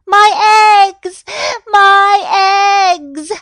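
very high, loud, drawn-out cry; distressed wail. A narrator's reading. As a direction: wail over the broken eggs. Goose cries over crunched eggs.

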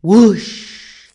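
loud whoosh with long hushing tail; imitated whoosh. A narrator's reading. King swinging on the wheel, imitated whoosh. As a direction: blow the word out like rushing air.

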